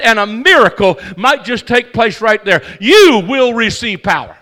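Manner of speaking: loud, ringing preacher's cadence; a fired-up pastor driving the point home; full-force preaching. Acted. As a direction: preach it out to the whole church.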